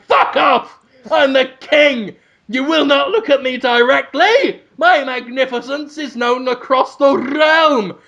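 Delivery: high, pompous royal outburst; imperious king. Acted. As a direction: dismiss a lowly helper like a haughty monarch. Acting imperious king dismissing helper.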